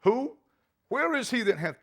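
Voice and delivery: high, rising cries of alarm; a shaken old man demanding an answer; trembling alarm. A narrator's reading. Isaac to Esau, trembling in alarm. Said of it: "Isaac trembled very exceedingly and said".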